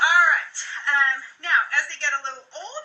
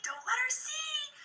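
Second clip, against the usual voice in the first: higher, slow, hushed repeat of "Don't let her see"; hushed playful; 13 semitones higher, 10 dB quieter, slower than usual. Retold or imitated. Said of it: "be like".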